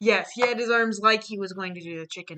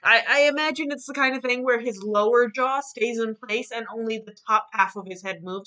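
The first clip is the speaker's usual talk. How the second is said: normal talking voice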